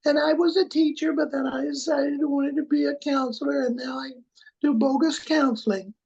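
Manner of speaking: high-pitched, sugary-small mousy wife voice; little mousy voice. Acted. Podcaster mimics the Speaker's wife's fundamentalist voice, little and mousy. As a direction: squeak it out small and high, well above your own voice. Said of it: "It's this little mousy voice".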